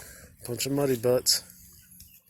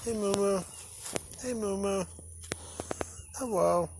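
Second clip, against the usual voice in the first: high-pitched pet-talk greeting; cooing to animals; 8 semitones higher, 3 dB quieter than usual. Real, not acted. Calling to the cows.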